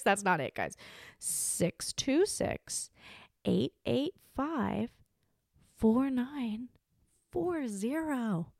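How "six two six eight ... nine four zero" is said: drawn-out digits, pitched a little high; very slow readout. Shown as a demonstration. Podcaster repeating the hotline number for callers, very slowly. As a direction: stretch every digit, pausing between groups.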